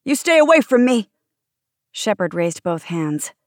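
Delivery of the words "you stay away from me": loud, high-pitched panic; terrified yell. A narrator's reading. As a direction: fend someone off, yelling in fear. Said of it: "terror in his eyes".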